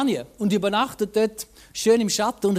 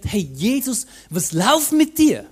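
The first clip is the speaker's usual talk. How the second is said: bewildered outburst; 3 semitones higher, 6 dB louder than usual. Retold or imitated. Preacher recalling his old reaction to the story, loud and baffled. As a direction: challenge Jesus like a baffled friend.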